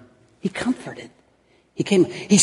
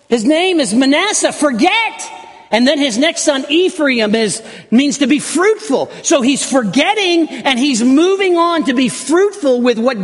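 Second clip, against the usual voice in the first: much higher and louder preaching burst; fervent preaching; 10 semitones higher, 4 dB louder than usual. Real, not acted. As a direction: preach it out, rising to full force. Preacher builds on Joseph's sons.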